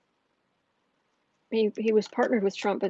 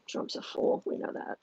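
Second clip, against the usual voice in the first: whispering; 8 dB quieter than usual. Real, not acted. Whispered aside about Trump.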